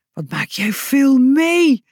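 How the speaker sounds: high, slow, awestruck exclamation; full of admiration. A narrator's reading. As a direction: marvel at it, stretching the words. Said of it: "zei ze vol bewondering". (vol bewondering)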